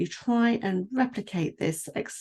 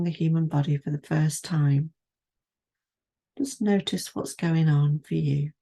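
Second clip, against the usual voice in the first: guided meditation; 2 semitones higher than usual. Acted. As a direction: ease the listener down through the body, unhurried. Leads a standing body scan.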